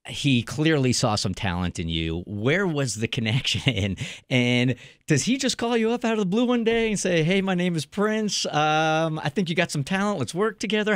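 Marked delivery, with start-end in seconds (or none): 4.3-10.9 high playful put-on voice, hammed up. Host mimics [name] calling out of blue.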